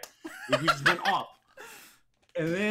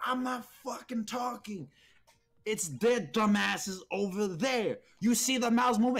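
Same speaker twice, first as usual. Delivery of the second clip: higher-pitched retold outburst of a scolded student; indignant protest; 6 semitones higher than usual. Retelling Kelvin's protest to teacher.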